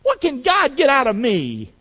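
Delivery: normal talking voice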